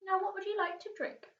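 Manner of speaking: put-on a courteous old tramp offering a seat; Mister Stink. A narrator's reading. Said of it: "said Mister Stink".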